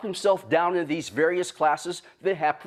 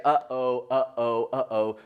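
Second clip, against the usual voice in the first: students' uh-oh; 7 semitones lower, slower than usual. Retold or imitated. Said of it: "he heard students say".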